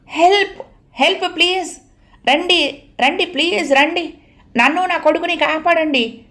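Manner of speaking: high, frantic cries for help; desperate shout for help. A narrator's reading. Trapped mother shouting to footsteps outside for help. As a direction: scream for someone outside to save her and her son. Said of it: "గట్టిగా అరిచింది".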